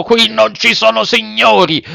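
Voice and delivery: high, hard puppeteer reply; stern acted rebuff; harsh retort. A narrator's reading. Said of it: "replicò duramente".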